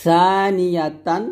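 tongue to upper teeth, start সানিয়াতান lightly; সানিয়াতান with thin, unhissed first consonant; thin tha. Shown as a demonstration. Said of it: "সা পাতলা".

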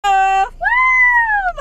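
high, loud screaming woo; party hype shout. Pretend. Hyping up friends before going out, loud woo.

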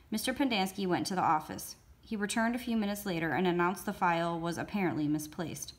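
ordinary delivery, nothing marked

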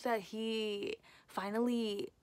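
talking while pointing at tooth; finger on lip. Real, not acted.

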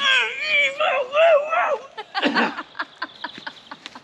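high squealing laughter; shrieking laugh. Real, not acted. Shriek of laughter during lift.

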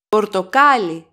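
Πορτοκάλι stressed on the second-last syllable, κά. Shown as a demonstration. Greek teacher demonstrates where the accent goes, Πορτοκάλι stressed on κά. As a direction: lean on κά in Πορτοκάλι. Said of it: "Second syllable from the last".

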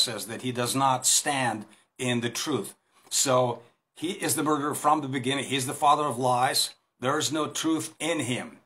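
normal talking voice

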